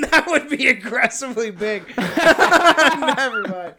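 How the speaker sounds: loud, laughing, words breaking up; can't stop laughing; laughing through words. Real, not acted. Laughing at own joke.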